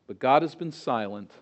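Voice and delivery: ordinary delivery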